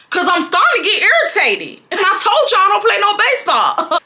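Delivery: very high-pitched comic rant; high, hammed-up irritation; irritated outburst. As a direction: squeal out an exasperated telling-off, fast at first. Comic irritated outburst at him, re-enacted.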